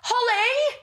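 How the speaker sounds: high, loud worried calling; panicked mum. Retold or imitated. Mum on FaceTime calling to her crying daughter, high panicked.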